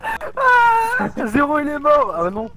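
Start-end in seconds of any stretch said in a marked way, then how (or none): 0.0-1.3 very high, loud shocked cry; shocked scream. Real, not acted. Teammate killed by ghost.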